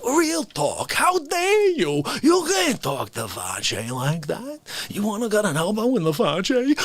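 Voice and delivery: indignant tough-guy put-on voice; Dick Facce. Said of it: "he says this to me".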